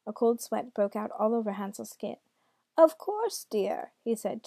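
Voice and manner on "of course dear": high, swooping put-on granny; grandmother voice. A narrator's reading. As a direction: reassure in a high granny lilt. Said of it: "in his best grandmother voice".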